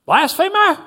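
high, loud cry of outrage; shouted accusation. A narrator's reading. Someone in the crowd at the trial, shouted accusation. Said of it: "someone shouted".

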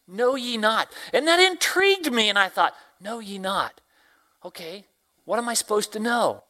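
usual speaking voice

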